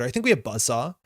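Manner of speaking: usual speaking voice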